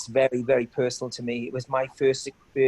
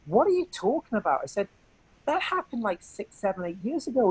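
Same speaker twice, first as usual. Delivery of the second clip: higher, incredulous pitch jumps; as if he can't believe the accusation; retold disbelief; 6 semitones higher than usual.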